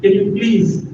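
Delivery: ordinary talk, nothing marked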